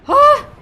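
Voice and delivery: sudden loud, high shriek of fright; short burst of a scream; startled yelp. Real, not acted. Startled during the game.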